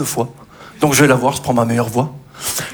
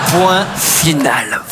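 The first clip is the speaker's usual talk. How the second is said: slow dictation; 2 semitones lower, much slower than usual. Pretend. Dictating end.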